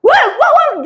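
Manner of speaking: hammed-up Batak outburst; loud Batak accent. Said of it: "mentor saya itu Batak". (logat Batak yang keras dan tinggi)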